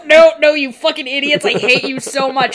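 loud, exasperated Merlin yelling at the idiots; furious refusal. Retold or imitated. Merlin refusing Arthur, loud and furious. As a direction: shout him down, fed up and furious.

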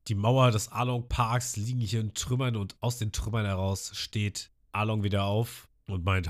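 normal talking voice

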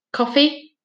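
firm, stern question; a child sternly bossing his father. A narrator's reading. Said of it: "said Finn sternly".

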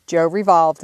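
plain reading voice

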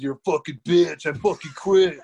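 angry outburst. Retold or imitated. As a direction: spit it out, furious and loud. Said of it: "he was like".

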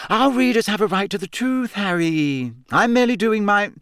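high, affected justification; lofty protest, pitched well up; self-righteous reporter. A narrator's reading. Rita defending her article to Harry, self-righteous.

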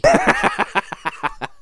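high-pitched cackling laugh; burst of laughter. Real, not acted. Laughs at the CQC joke.

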